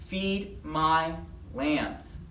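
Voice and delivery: very slow, evenly spaced words; measured group recital at normal volume; leading a recitation. Acted. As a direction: draw out each word for children to join in. Leading children in memory verse.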